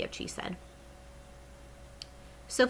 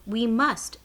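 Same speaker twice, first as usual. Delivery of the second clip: cried out; 4 semitones higher, 5 dB louder than usual. Acted. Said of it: "Sophie cried".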